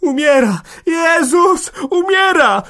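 panicked, very high shrieks; loud wailing cries of alarm; screaming. A narrator's reading. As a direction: scream in horror at a death. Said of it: "wrzeszczała Hanka".

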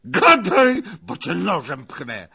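high, loud burst on the first word; threatening yell. Acted. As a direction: shriek the order, then threaten. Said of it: "wrzasnął Zagloba". (groźny wrzask)

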